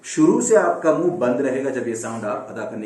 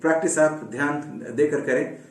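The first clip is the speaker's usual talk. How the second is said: ordinary talk, nothing marked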